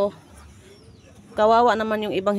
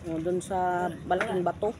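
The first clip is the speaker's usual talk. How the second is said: normal talking voice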